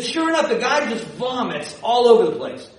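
ordinary talk

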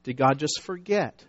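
normal talking voice

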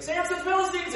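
high, loud hammed-up warning cry; Delilah's staged alarm, high and loud; mock alarm shout. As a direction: yell it like the enemy is at the door. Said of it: "she's like".

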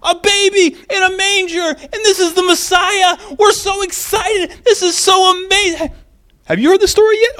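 high joyful shouting; overjoyed shepherds. Retold or imitated. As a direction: shout the news with giddy joy. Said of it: "they're so incredibly excited".

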